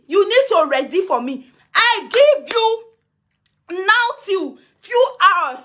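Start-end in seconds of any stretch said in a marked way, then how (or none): 1.8-5.6 drawn out, louder and higher; stretching each word as a threat; slow warning. Real, not acted. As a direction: lay down the ultimatum slowly. Threatening deadline to Mama Nura.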